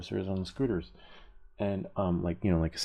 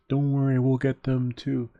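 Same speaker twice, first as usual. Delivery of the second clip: loud, higher imitated cop comeback; officer's retort; 7 semitones higher, 7 dB louder than usual. Officer answers protesting cyclist, loud imitated retort. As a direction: mimic an officer firing back.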